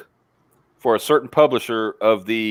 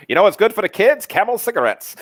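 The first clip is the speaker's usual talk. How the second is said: higher, louder vintage commercial pitch; old-timey ad voice; 9 semitones higher, 3 dB louder than usual. Pretend. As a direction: sell it like a fifties ad man. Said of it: "I'm expecting Xavier any day to say".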